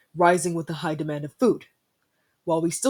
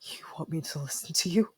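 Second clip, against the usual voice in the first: softer whispered defiance; 7 dB quieter than usual. Acted. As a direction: hush it but stay defiant.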